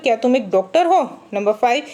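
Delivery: usual speaking voice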